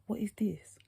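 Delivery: hushed "what is this"; whispered thought. Retold or imitated. Thinking to herself about the staring eyes, whispered. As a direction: whisper it to yourself.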